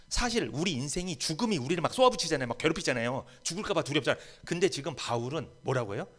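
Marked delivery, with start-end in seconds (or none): none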